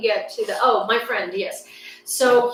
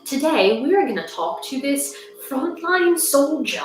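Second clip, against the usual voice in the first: hammed-up, higher TV presenter delivery; mock reporter intro; 6 semitones higher than usual. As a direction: introduce your guest like an over-serious war correspondent. Mimicking a skit's reporter intro.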